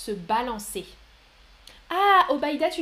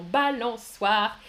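Balançoire said slowly, every syllable clear; slow spelling-out; 4 dB louder, much slower than usual. Shown as a demonstration. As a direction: stretch out Balançoire for learners. (Balançoire, lentement et bien articulé)

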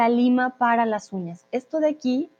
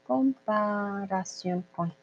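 slow, quiet syllable-by-syllable "comparación con"; measured, hushed word-by-word dictation; 2 semitones lower, 8 dB quieter, slower than usual. Shown as a demonstration. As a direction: draw out each syllable softly for a learner. Teacher spells out phrase for student.

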